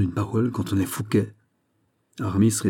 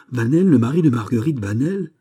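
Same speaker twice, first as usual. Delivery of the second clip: rising, louder cry of disbelief; shocked cry; 5 semitones higher, 6 dB louder than usual. A narrator's reading. Aramis, rising to his feet, cries out in shock. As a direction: jump up and cry out the name. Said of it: "s'écria-t-il en se relevant".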